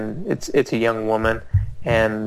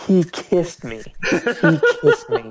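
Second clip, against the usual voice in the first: high-pitched mock accusation; accusing woman; 8 semitones higher, slower than usual. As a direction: imitate a distraught woman pointing the blame, drawn out. Retold accusation by the distraught woman.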